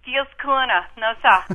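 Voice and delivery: put-on loud, high, proud Boston Dorchester accent. Caller names her Dorchester neighbourhood to host, broad Boston accent.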